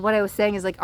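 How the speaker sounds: ordinary talk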